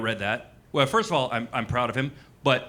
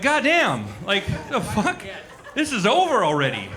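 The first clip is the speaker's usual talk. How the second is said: mock shocked laugh; 6 semitones higher than usual. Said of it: "And you're like".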